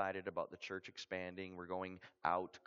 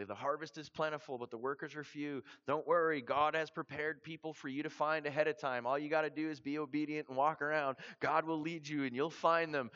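higher and louder, eager coaching voice; trainers' pep talk; 7 semitones higher, 6 dB louder than usual. Retold or imitated. Trainers sending him off to Aruba, upbeat and loud. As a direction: pump up the new recruit like a keen trainer. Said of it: "and they said".

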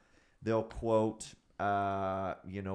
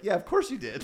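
laughing sarcastic; 11 semitones higher, 7 dB louder than usual. Retold or imitated. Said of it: "I'm like".

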